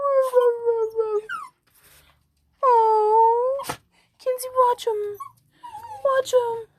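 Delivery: very high, cooing pet voice; squeaky high sweet-talk to a chihuahua. Real, not acted. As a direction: coo and coax the puppy to bark. Coaxing dog, mimicking barks.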